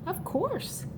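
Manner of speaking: ordinary talk, nothing marked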